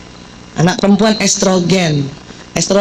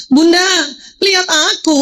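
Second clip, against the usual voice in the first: put-on child pleading; 6 semitones higher than usual. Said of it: "Pernah nggak begitu anaknya".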